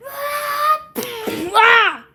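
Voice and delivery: high screaming malfunction cry; overloaded robot scream. Acted. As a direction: shriek like a failing machine.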